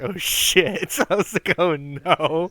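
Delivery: loud, high retold panic, breaking into laughter; loud mock alarm through laughs; laughing dismay. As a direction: crack up while reliving an embarrassed realisation. Retelling being overheard, laughing in dismay.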